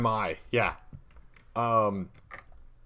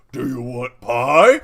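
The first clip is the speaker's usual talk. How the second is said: very loud, drawn-out ogre question; big simple ogre; 13 dB louder, much slower than usual. Acted. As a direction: bellow the offer slowly. Ogre character offering pie to players.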